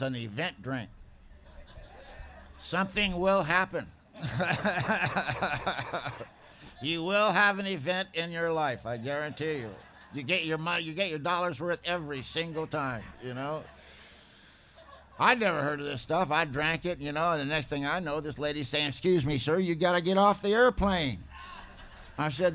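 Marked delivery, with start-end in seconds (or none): 4.2-6.2 rapid-fire rattling laugh; long, pulsing wicked chuckle; staccato cackle. Real, not acted. Joking about the event drink. 18.9-21.2 lightly acted insistent cabin crew, raised voice; louder, higher, telling a passenger to leave; mock-firm airline lady; imitated flight attendant. Acts attendant ordering him off plane.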